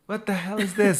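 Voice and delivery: high, loud baffled cry; a newcomer who cannot understand anything; baffled outburst. Retold or imitated. Newcomer hears the Liverpool accent, baffled cry. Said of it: "I was like".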